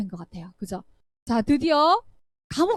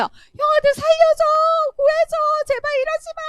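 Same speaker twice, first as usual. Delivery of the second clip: high, loud pleading scream; desperate cry for help; 7 dB louder than usual. Acted. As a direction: beg the brothers to save you, terrified. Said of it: "이렇게 외치고". (구덩이 속 요셉이 형들에게 살려달라고 높게 외치는 비명)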